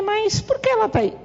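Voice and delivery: retold mother surprised on the phone.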